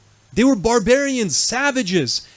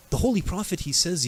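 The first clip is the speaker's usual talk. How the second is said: normal talking voice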